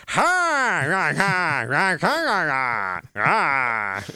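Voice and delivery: high, loud garbled rah-rah; lipless Red Skull. Pretend. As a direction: bark it out with no lips. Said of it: "Red Skull opens his mouth and goes".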